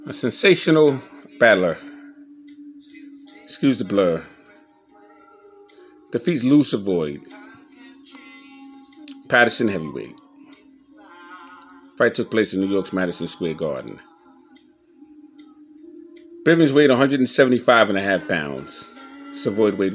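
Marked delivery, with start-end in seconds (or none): none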